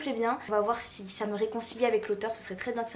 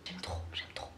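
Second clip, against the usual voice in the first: whispered, real affection; hushed adoration; 10 dB quieter than usual. Real, not acted. Loving a friend's drawing, hushed adoration. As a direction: whisper it to yourself, smitten.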